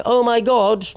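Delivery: high put-on exclamation; mock office uproar. Host mimicking the dental office's uproar, exaggerated shocked voice. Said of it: "they don't speak like that".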